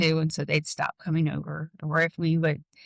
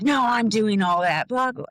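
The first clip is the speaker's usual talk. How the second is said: higher-pitched mock indignation; mad dad; 4 semitones higher, 4 dB louder than usual. Dad insisting he does it all, mock mad. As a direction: imitate a defensive dad brushing someone off. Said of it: "my dad got mad".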